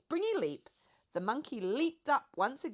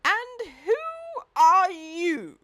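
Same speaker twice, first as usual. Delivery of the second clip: high, loud and slow demanding voice; booming Duke; 12 semitones higher, 12 dB louder, much slower than usual. A narrator's reading. The Duke demands to know who the boy is. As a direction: demand it grandly.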